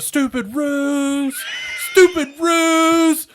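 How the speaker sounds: high, drawn-out mock yell of a movie villain. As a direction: holler it way up high, stretching the last word. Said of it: "That's all he's yelling".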